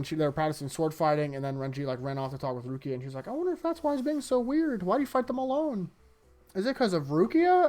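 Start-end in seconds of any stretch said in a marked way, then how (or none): 3.3-7.7 high-pitched mock girl wondering aloud; Hinamori. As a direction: lift your voice high to imitate her musing. Voicing Hinamori's wondering in retelling.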